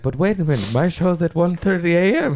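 high-pitched imitation of a slight German accent. DJ comparing late show times, imitated German accent. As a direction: attempt a light German accent, voice lifted high. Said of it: "he has a slight German accent".

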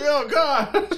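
high, hammed-up cry; mock horror. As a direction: cry out in fake anguish. Acting out killed-kid scene.

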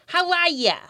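put-on high, squawked Boston accent greeting; talking seal with a thick Boston accent; Hoover. Storyteller demonstrating the seal's greeting, in Boston accent. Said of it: "he would say things like".

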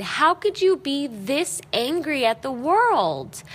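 drawn-out, disbelieving retold question; incredulous. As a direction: plead in mild disbelief. Husband's incredulous question, acted.

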